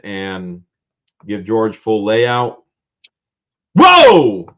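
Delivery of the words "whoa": loud high whoa of surprise; surprised. Real, not acted. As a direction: react with real surprise. Surprise at a full box.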